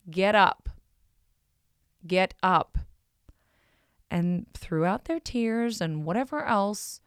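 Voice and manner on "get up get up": loud no-nonsense command shouted across; firm yell. Retold or imitated. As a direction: bark it like a coach at a fallen kid. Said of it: "I yell out and say".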